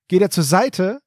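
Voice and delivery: normal talking voice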